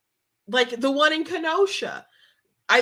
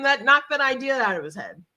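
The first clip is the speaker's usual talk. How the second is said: ordinary talk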